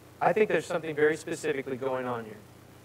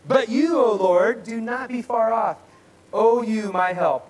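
loud, high, impassioned declaiming; scripture reading redone; 7 semitones higher, 7 dB louder than usual. Acted. Preacher repeating the psalm's plea after interruption, loud declaiming. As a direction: cry out the plea at full voice. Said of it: "I'll do it again for you".

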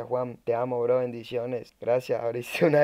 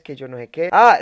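ordinary talk, nothing marked

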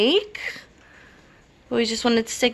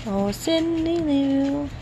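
cooing; 5 semitones higher than usual. Real, not acted. Mom cooing at baby.

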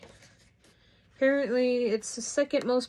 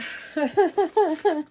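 burst of laughter; 6 semitones higher, 6 dB louder than usual. Real, not acted. Laughs after outburst.

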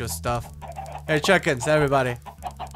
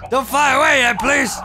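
squealing plea, higher and louder; high pleading; 9 semitones higher, 7 dB louder than usual. Real, not acted. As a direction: beg it not to fly off. Pleading with pet to stay.

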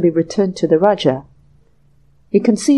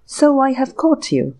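stern detective; 6 semitones higher than usual. A narrator's reading. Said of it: "the stranger repeated".

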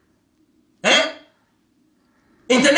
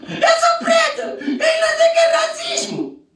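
very high, squealing caricature; hammed-up falsetto wail; mock whining voice; 14 semitones higher than usual. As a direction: whine it way up high, mockingly. Mocking acted quote of a denier.

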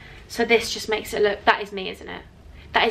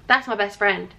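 ordinary talk

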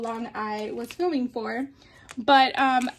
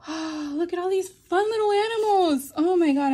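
excited; 6 semitones higher, 5 dB louder than usual. Real, not acted. Gushing over craft stickers.

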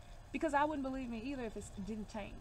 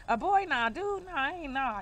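loud hammed-up refusal; mock dismissive; 2 semitones higher, 8 dB louder, slower than usual. Reader acting out a viewer turning down an unlikely partner, mock dismissive. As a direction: wave the idea away loudly, playfully. Acts out rejecting a suggested partner.

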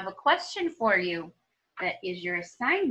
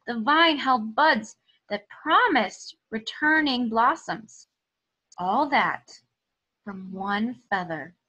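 lively, expressive storybook reading; reading to children; 4 semitones higher, 4 dB louder than usual. Acted. Teacher reading a story aloud to her students, expressive storytelling. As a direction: tell it like a picture book for the class. Reads picture book aloud.

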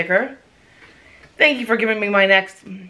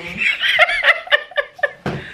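high laugh. Real, not acted. Laughing at friend's reaction.

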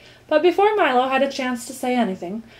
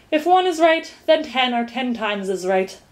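ordinary delivery, nothing marked

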